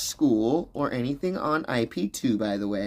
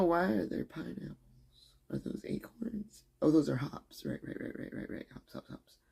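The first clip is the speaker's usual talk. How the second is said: muttered to self; 4 semitones higher, 9 dB quieter than usual. Real, not acted. Mumbling while reading chat.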